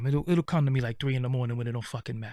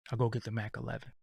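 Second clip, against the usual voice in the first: hushed quick aside; 5 semitones lower, 10 dB quieter, faster than usual. Acted. Hushed fast comic aside.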